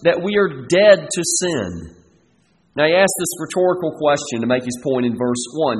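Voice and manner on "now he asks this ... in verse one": ordinary talk, nothing marked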